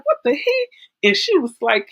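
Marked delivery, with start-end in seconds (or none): 0.0-0.7 high, stunned disbelief; shocked disbelief. Retold or imitated. In the bar, reacting to Trump's win with high shocked disbelief. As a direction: pitch it way up in shock. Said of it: "I was like".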